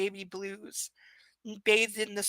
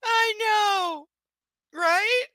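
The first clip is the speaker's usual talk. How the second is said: much higher, giddy disbelief; excited gossip; 11 semitones higher, 5 dB louder than usual. Real, not acted. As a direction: gush like spilling gossip.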